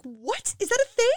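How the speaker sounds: that shoots way up high in disbelief; shocked disbelief. Retold or imitated. Mom hearing spiders carry babies, high shocked squeal. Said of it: "I'm like".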